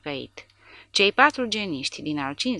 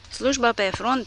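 cheeky shout; 7 semitones higher, 3 dB louder than usual. Acted. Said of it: "strigă Porta nerespectos".

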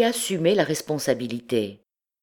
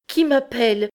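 high, louder startled question; startled awake; 9 semitones higher, 5 dB louder than usual. A narrator's reading. Dazed Jupiter jolted by his name, startled. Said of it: "comme éveillé en sursaut".

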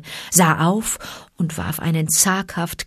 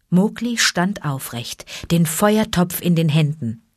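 usual speaking voice

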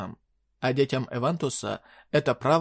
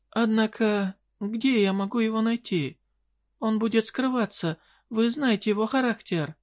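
high desperate queen voice; pleading fairy queen; 8 semitones higher than usual. Acted.

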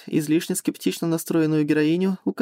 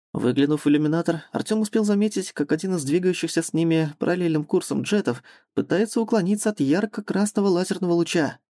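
ordinary delivery

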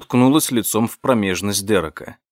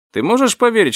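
incredulous; 11 semitones higher, 4 dB louder, faster than usual. Acted. Gossiping, incredulous.

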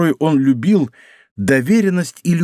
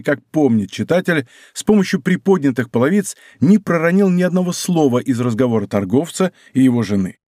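usual speaking voice

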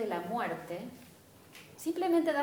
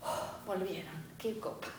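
tender relief; 2 semitones lower, 6 dB quieter than usual. Retold or imitated. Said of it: "Vuelven de colegio y es".

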